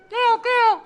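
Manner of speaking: high loud peacock squawk; peacock call. Pretend.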